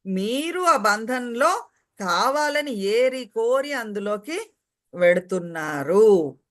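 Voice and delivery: ordinary talk, nothing marked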